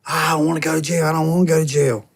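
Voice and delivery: mock whimper of a dad who won't go to jail; whiny pleading dad.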